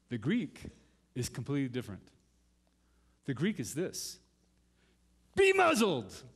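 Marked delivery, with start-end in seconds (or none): 5.3-6.2 louder, higher, forceful command; sharp muzzling order; intense command. Retold or imitated. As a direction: clamp it shut like a muzzle. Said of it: "It has that intensity".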